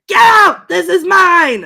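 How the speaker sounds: loud, high territorial yell; mock angry shout. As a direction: bellow it like an angry ghost. Quoting an angry ghost on train.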